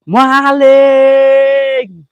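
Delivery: long high yell of maling; a drawn-out cry of maling; shouting thief. Shown as a demonstration. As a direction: bellow it out to call the spirits. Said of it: "teriak maling".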